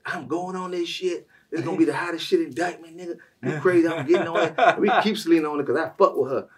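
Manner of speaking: high, loud hyped-up voice; excited hype. Retold or imitated. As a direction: shout it like she's all in. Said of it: "She's like".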